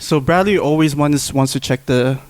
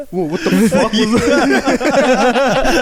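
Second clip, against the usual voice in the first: laughing mock startle; 9 semitones higher, 3 dB louder, much slower than usual. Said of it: "You'd be like".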